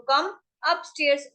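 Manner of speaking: drawn-out teaching delivery; slow demo phrase; slow model phrase. Shown as a demonstration. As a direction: stretch it so learners can follow. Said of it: "teacher models English phrase".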